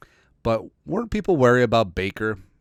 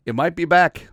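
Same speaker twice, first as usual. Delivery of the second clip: excited hope; 8 semitones higher, 6 dB louder than usual. Real, not acted. Hyped hope for USC defense.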